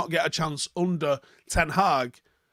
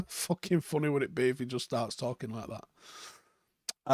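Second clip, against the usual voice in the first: laughing; 3 semitones lower, 5 dB quieter than usual. Real, not acted. Laughing at imagined scene.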